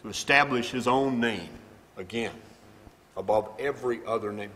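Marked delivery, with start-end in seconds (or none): none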